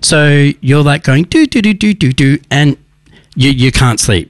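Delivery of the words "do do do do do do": high sing-song doo-doo noises; restless doo-doo-doo. Retold or imitated. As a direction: imitate an idle mind pottering along with doo-doos. Imitates restless mind ticking over.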